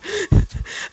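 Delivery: sudden squeaky laugh; high burst of laughter. Real, not acted. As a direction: let a high laugh escape. Laughing at the client's advance.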